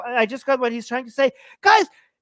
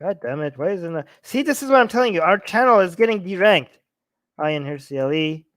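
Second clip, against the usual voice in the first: ordinary talk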